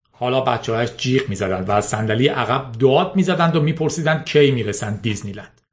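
plain reading voice

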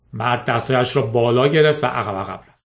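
plain reading voice

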